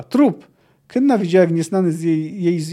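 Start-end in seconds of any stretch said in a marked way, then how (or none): 0.0-0.5 high, loud one-word cry; sharp raised yell; shouted. A narrator's reading. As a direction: fire the word out loudly. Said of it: "krzyknęła".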